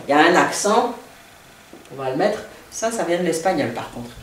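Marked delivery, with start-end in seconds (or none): none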